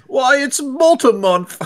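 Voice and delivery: put-on very high, slow Cockney boy. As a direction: squeak it out like a little Cockney boy. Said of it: "your voice needs to go higher".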